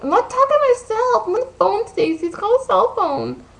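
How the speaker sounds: very high, loud indignant imitation; hammed-up high-pitched protest; mock screeching. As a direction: yell back like an overdramatic friend. Acted quarrel, imitating upset friend.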